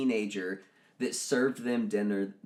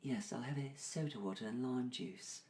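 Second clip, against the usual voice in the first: put-on a light, prim English accent from the woman; lightly imitated British lady ordering; 2 semitones higher, 14 dB quieter than usual. Customer at the table orders in a light English accent. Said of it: "she's British".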